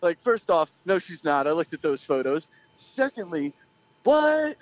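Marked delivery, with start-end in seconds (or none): 4.0-4.6 high-pitched, stunned yelp of disbelief; incredulous. Retold or imitated. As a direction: shoot the pitch right up in retold shock. Retold disbelief at the girl's age.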